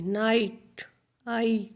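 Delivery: नाइट, then its "eye" vowel alone as आई; vowel sound demonstrated. Shown as a demonstration. Teacher isolating the vowel for pupils, नाइट then a separate आई. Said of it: "आ की sound दे रहा है".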